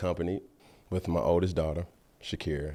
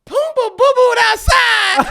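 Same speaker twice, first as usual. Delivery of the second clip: put-on high, loud, slow girl's cry; daughter's alarm; 11 dB louder, slower than usual. Daughter running to tell dad, high loud shriek. Daughter reporting brother's accident.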